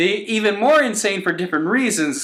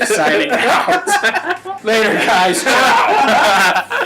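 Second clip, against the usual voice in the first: laughing; 4 semitones higher, 4 dB louder, much slower than usual. Real, not acted. Signing off, laughing.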